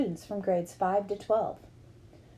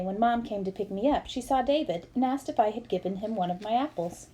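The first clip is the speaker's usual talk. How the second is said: ordinary delivery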